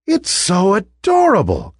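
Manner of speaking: high, gushing delight; delighted cry. Acted. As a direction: coo over a cute toy. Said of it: "cried one of the ballerinas".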